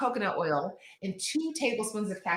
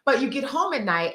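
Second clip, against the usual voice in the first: usual speaking voice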